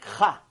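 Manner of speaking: "Cha" with a scraped Ch from the throat; throaty Cha. Shown as a demonstration. Example of Hebrew throat letters, throaty "Cha". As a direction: rasp "Cha" low in the throat. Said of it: "letters in the Hebrew alphabet that use the throat".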